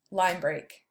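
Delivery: ordinary delivery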